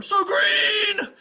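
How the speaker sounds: drawn-out high, loud call; desperate shout. Acted. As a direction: scream your master's name through a shut door. Squire banging on the door.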